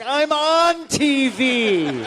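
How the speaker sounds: very slow, high, loud exasperated retort; incredulous retort. Retold or imitated. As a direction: stretch it out in disbelief. Retorting in disbelief.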